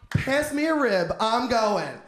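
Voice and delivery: ordinary talk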